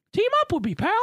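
high squeaky Mickey voice; Mickey Mouse voice. Retold or imitated. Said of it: "Mickey's like".

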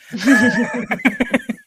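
bright burst of laughter; laughing. Real, not acted. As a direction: laugh out loud. Laughing at old intro.

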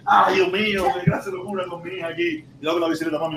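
usual speaking voice

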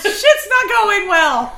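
slow, loud, laughing wail; mock sad lament. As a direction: drag it out, half laughing. Voicing sad Penny Pretty.

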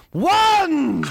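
high, loud drawn-out shout; referee's count. Retold or imitated. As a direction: bellow the count over a floored boxer.

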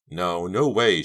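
ordinary delivery, nothing marked

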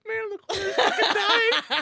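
talking through laughter; wheezing with laughter; laughing. Real, not acted. Laughing at bystander.

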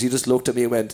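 normal talking voice

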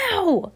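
high pained "Ow!"; quick storybook yelp of pain. A narrator's reading. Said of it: "Heidi yelped".

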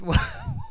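short, very high giggle; a quick squeak of laughter; high burst of laughter. Real, not acted. [name] still giggling over the speech, high laugh. Laugh breaks into his sentence.

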